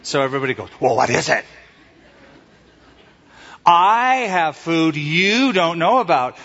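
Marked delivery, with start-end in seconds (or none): 0.8-1.4 impatient curiosity. Retold or imitated. Everybody's reaction to the taunt. 3.7-6.4 slow and teasing, "I" and "you" stretched high; stress on I and YOU. Retold or imitated. Jesus reeling in the disciples, stress on "I" and "you". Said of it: "two words that have the emphasis".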